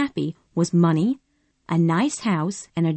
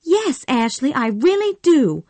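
high, louder eager yes; eager cry; 8 semitones higher, 4 dB louder than usual. Acted. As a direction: jump at it, pitched up. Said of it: "cried Scarlett".

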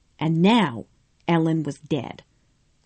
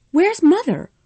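much higher, louder urgent question; crying out; 11 semitones higher, 5 dB louder than usual. A narrator's reading. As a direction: demand it anxiously. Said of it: "Scarlet cried".

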